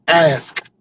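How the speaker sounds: "Ask" over-enunciated, each consonant separate; three-syllable ask. Shown as a demonstration. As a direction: split "Ask" into three beats, the final k released. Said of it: "with three syllables".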